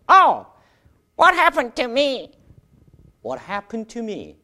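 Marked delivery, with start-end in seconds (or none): 0.1-2.3 high, slow put-on student voice; Jack's puppet voice. Jack answers the teacher in his high put-on voice. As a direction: play Jack, stretched and high. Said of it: "잭 한번 만들어 보시죠".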